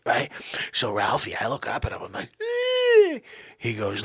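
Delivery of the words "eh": high startled squeak; nervous squeak. Retold or imitated. Frightened kid squeaking up at Santa. Kid's scared noise at Santa.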